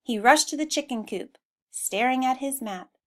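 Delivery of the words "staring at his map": plain reading voice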